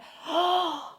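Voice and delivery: sharp high gasp. Acted. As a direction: gasp in dismay. Balloon flies off.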